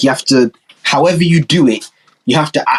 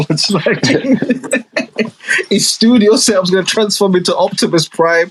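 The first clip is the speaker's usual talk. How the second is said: words breaking into laughter; laughing; 5 semitones higher than usual. Real, not acted. Joking about Will's studio turning into Optimus Prime, laughing. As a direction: laugh through the joke.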